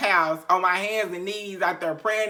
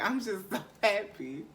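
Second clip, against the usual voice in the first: teary happiness; 8 dB quieter than usual. Retold or imitated. Retold crying tears of joy.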